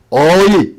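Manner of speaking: long drawn-out Chungcheong "어이"; Chungcheong dialect. Shown as a demonstration. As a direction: stretch out the Chungcheong hail. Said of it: "충청도 말은 우리가 잘 아는 대로".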